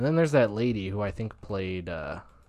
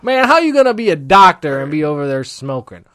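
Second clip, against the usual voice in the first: loud, higher-pitched call-out of a smoker; scolding TV characters; 6 semitones higher, 15 dB louder than usual. Acted. Calling out a doctor for smoking, loud scolding character voice. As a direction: belt it out like an outraged sitcom colleague. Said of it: "conglomeration of all the other characters".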